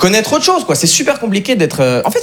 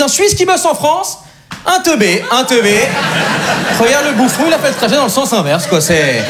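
mocking French onlooker; 6 semitones higher than usual. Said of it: "ils disent".

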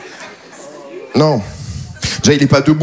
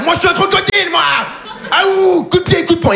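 high shouted cartoon croc, full of itself; much higher, yelling mascot crocodile; 10 semitones higher than usual. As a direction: squeal it like a tough little crocodile. Crocodile on the jogging talks.

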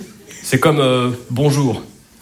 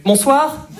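high, rising corrective greeting; mock-polite reproachful lilt; pointed correction; 9 semitones higher than usual. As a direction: correct them with a pointed 'Bonsoir' pitched up high. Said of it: "on peut te saouler".

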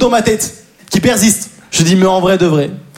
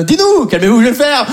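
normal talking voice

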